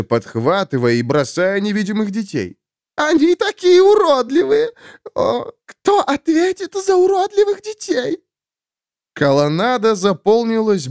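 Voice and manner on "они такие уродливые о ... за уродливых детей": high howling wail. Acted. Dead woman howls searching for her child. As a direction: howl it like a grieving ghost.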